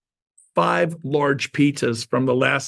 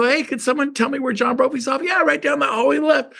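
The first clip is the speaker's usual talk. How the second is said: high office voice; 10 semitones higher than usual. Retold or imitated.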